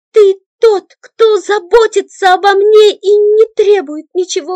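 high, loud tearful pleading; girl gripping his shoulders, pleading in tears; desperate plea. A narrator's reading. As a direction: beg him to believe you.

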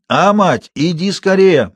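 put-on insistent old man's plea; old man calling urgently. A narrator's reading. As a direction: summon help from the dark. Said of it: "вдруг заорал он отчаянно".

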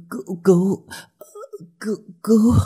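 very high strangled cries for help; choking plea. A narrator's reading. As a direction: plead in broken, high gasps. Strangling woman begs for help. (kêu cứu nghẹn ngào)

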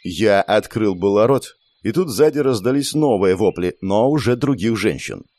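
usual speaking voice

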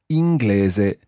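inglese spoken slowly, each syllable clear; slow teaching model. Shown as a demonstration. Teacher slowly models inglese for Katie. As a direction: draw out inglese for the learner. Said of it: "now if you were English".

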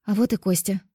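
usual speaking voice

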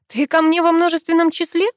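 high-pitched, incredulous question; surprised. A narrator's reading. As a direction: ask it in astonishment. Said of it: "удивилась я".

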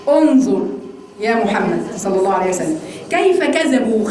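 ordinary delivery

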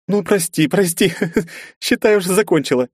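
high apology through giggles; stifling giggles. Acted. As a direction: try to hold back laughter. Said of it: "подавила неконтролируемые смешки". (сдерживая смех)

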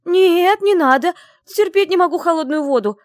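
very high, loud squeal of panic; shrieking. Acted. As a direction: squeal in horror at cold water. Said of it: "взвизгнула я".

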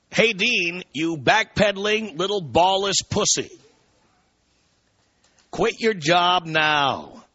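scornful dramatic reading; indignant email read. Acted. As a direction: read out the listener's rant at Dean with relish. Reads listener email theatrically.

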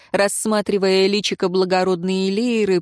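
ordinary talk, nothing marked

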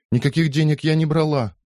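high protest. A narrator's reading. Accused girl protests.

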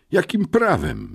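high-pitched outraged challenge; indignant exclamation. A narrator's reading. Boy challenges his captor, exclaiming indignantly. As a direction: protest sharply, pitch leaping up. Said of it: "wykrzyknąłem oburzony".

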